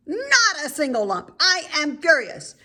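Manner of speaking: high, loud royal rage; furious yell. Acted. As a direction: shriek it as a furious queen. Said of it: "She yelled".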